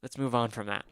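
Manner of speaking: usual speaking voice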